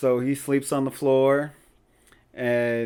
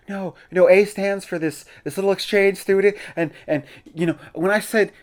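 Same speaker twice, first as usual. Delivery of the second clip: higher, hurried backpedalling, a touch of panic; flustered downplaying; 6 semitones higher, 4 dB louder than usual. Teen girl covering her diary to the crush, flustered and higher. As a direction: talk your way out of a crush. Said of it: "just tries to downplay everything".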